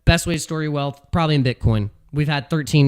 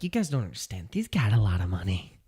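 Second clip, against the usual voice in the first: lower, softer, breathy hushed Trump voice; Trump impression; 7 semitones lower, 8 dB quieter than usual. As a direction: lean in like Trump sharing a secret. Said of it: "That's how he would say it".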